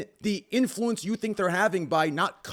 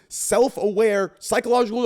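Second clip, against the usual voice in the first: ordinary talk, nothing marked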